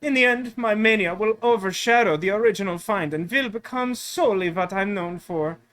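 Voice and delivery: grandiose German tourist; German accent. Acted. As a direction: spin out big dreams in a German accent. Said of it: "clearly the German tourist sounds German".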